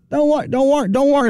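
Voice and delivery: high, stammering Brooklyn bear voice; Mister Bear fussing in thick Brooklyn; Brooklyn accent. Acted. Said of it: "That's how they speak in Brooklyn".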